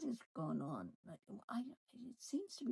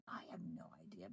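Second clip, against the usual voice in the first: whisper; 11 dB quieter, much faster than usual. Real, not acted. Co-host whispers about the awkward couple to her partner, quick hushed whisper. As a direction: drop to a quick unvoiced whisper. Gossiping about an unsettling husband.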